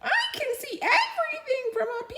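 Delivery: very high and fast; tiny mouse voice. Acted. Mouse on top of the tractor, squeaky and quick. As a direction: chirp it quickly like a thrilled little mouse.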